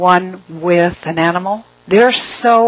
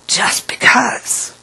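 whispered aside; 5 semitones higher, much slower than usual. Shown as a demonstration. Repeated as a breathy aside.

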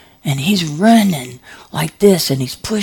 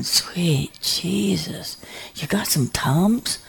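slow whispered plea for relief; pained whisper; 6 dB quieter, slower than usual. Retold or imitated. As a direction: breathe it out, hurting and slow.

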